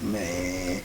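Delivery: a low, hushed "man"; awed under breath. Retold or imitated. Teller staring at the scene, hushed and awed. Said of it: "I'm like".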